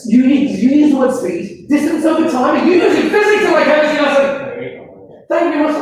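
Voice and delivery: high, loud teacherly protest; mock-indignant scolding. Real, not acted. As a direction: tell off a pupil in mock outrage.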